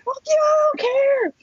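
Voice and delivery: very high, drawn-out mock yell; yelling back. Woman retorting to her yelling friend, high mock yell. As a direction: re-enact yelling back, pitch way up and slow. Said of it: "Maggie yells all the time".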